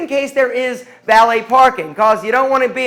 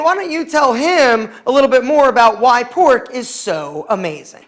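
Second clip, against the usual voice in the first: ordinary delivery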